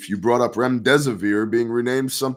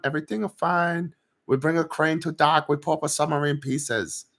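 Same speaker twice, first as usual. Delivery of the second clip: mock broken-English accent; 4 semitones higher than usual. Mock foreign official explaining sub.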